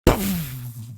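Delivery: explosive mouth-made blast; sudden puff of a sound effect; imitated burst. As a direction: imitate something bursting open. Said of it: "you will also hear".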